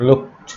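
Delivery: "লুকড" said as "lookt"; final -ed as T. Shown as a demonstration. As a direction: end "লুকড" with a t, no d. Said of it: "ইডি উচ্চারণ হবে ট". ("লুকড"-এর শেষে ট উচ্চারণ)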